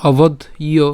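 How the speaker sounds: "অবধ ইয়" broken into obodh, pause, iyo; "অবধ ইয়" carefully separated for learners; split pronunciation. Shown as a demonstration. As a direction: stop on the dh of "অবধ", then add "ইয়" as iyo. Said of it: "ওকার যুক্ত হলে ইয়".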